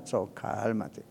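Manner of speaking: low, soft, hushed 'cálmate'; calming down. Acted. Preacher warning himself off the hospital, calming. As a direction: drop right down to soothe.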